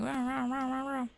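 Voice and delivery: high playful rah rah imitating a scurrying bug; scurry it out in a silly high voice; mock cockroach scurry noise. Mimicking the cockroach scurrying around her bathroom. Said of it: "scurrying around the room like".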